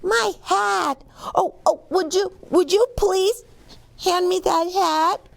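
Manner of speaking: high, eager pleading; relieved Milo. Acted. Milo asks for his hat back from the crowd. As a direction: beg politely, excited.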